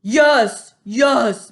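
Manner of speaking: loud mocking boom; booming insistence. A narrator's reading. Bloodbottler contradicts the BFG. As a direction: boom it right back. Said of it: "boomed the blood bottler".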